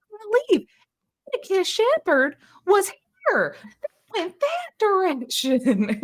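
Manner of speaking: very high, loud, tearful fan squeal; starstruck fan. Acted. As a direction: swoon as if you just saw your idol. Starstruck fan after celebrity passes.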